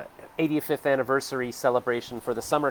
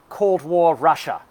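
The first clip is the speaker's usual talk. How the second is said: slow, louder British punchline, a touch higher; British accent; 4 semitones higher, 5 dB louder, slower than usual. Retold or imitated. Vlogger delivers the British comedian's punchline, slow British accent. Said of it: "do the British accent for the British comedians".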